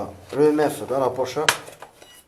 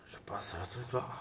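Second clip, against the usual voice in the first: whispering; 5 semitones lower, 15 dB quieter than usual. Real, not acted. Muttered aside while filming.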